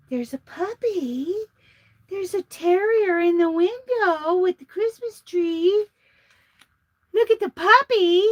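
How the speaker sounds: sweet high voice melting over a puppy; cooing at puppy. Real, not acted.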